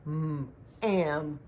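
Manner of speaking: "am, am" blended slowly; blending sounds. Shown as a demonstration. Teacher blending sounds into a word for children. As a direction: stretch then blend "am". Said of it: "and blend them together".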